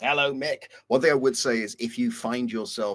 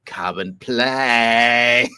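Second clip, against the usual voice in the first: loud, very slow, drawn-out call; sing-song shout out the window; 11 dB louder, much slower than usual. Pretend. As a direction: bellow it out the car window for the neighbours. Calling out the car window.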